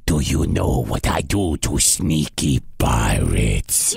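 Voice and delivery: very low, rumbling giant threat; menacing giant. Acted. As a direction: threaten from deep in the throat. Giant threatens captured Pete.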